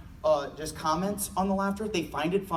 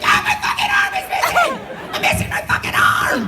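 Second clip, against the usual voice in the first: high, loud mock agony shout; much higher, louder panic yell; hammed-up screaming; 8 dB louder than usual. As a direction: scream like the arm is gone. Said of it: "No, they're like".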